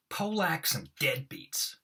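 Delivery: theatrical overplayed read; overacted Moss. Shown as a demonstration. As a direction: ham up the other guy's line. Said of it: "I really overact them".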